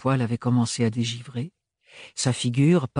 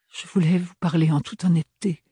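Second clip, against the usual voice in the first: higher, faltering; balbutiant; 6 semitones higher than usual. A narrator's reading. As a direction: stammer it out nervously. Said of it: "balbutia-t-elle". (balbutié d'une voix haute)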